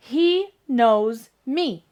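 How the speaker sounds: very slow, every word separate; slow model sentence. Shown as a demonstration. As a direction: dictate "He knows me" word by word. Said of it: "So, I need to say".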